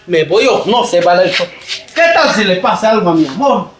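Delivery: ordinary talk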